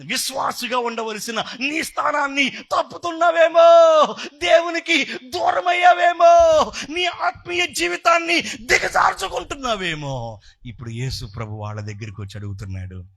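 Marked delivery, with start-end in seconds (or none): 0.1-10.4 full-force preaching. Real, not acted. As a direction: preach it at the top of your voice. Preacher voices bird, then exhorts. (గొంతెత్తి ఉద్వేగంగా ప్రసంగం)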